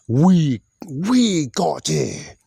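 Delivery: high, drawn-out imitation of a wrestler; mimicked wrestler. Fan quoting Asuka as she looks at Rhea, high-pitched mimicry. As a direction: stretch it out high, mimicking her.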